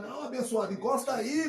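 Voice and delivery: high, loud coaxing voice; mock gang member urging him; insistent call. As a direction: push him to come over. Inmates insisting again.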